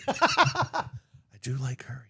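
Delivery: sudden whispered confession; whispered aside. Real, not acted. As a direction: drop to a whisper, half to yourself. Aside after laughing.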